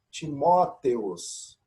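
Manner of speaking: Timóteos read out syllable by syllable, slowly. Shown as a demonstration. Teacher reading a Greek name to the class slowly. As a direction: spell out Timóteos slowly, each vowel kept apart. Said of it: "Percebam aqui".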